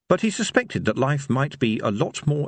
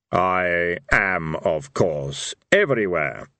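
deep Death voice; 4 semitones lower, much slower than usual. Acted. Death speaks.

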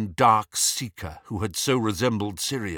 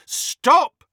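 high shout, building louder on the last word; 12 semitones higher, 5 dB louder, much slower than usual. A narrator's reading. Harry ordering the elf to stop, shouting. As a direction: shout the command, more forcefully at the end. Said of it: "shouted Harry".

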